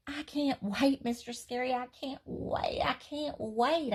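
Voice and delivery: put-on high, bouncing little girl squealing; too excited to hold still; thrilled child. Child thrilled, flinging arms.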